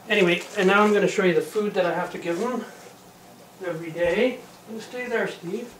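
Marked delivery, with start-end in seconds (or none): 4.7-5.7 soothing a nervous cat. Real, not acted. Gently tells the cat to stay.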